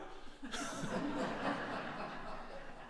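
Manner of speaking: quiet airy laugh at himself; soft unvoiced chuckle; breathless laugh. Real, not acted. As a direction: laugh under the breath, amused. Laughs at own denial.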